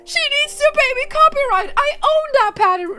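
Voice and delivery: loud, high-pitched protest; indignant outburst. Acted. Streamer protesting someone singing in the padded room, indignant shout.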